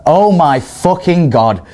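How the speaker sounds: shocked mum. Retold or imitated. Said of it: "she's like".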